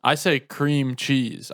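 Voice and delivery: CREAM CHEESE slowed into two separate words. Shown as a demonstration. Showing a friend his own way, slowed down. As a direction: take your time over CREAM, then CHEESE. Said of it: "I, like, slow it down more".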